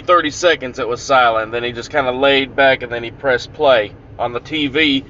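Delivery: normal talking voice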